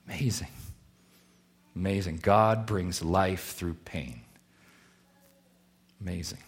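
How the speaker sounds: preacher in quiet awe after thanking mothers; hushed awe. Acted. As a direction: let it sink in quietly. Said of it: "quiet awed repetition".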